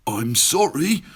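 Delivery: the scared dragon apologises to the beast; spluttering dragon. Acted. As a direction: blurt it out in a panic. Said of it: "he spluttered".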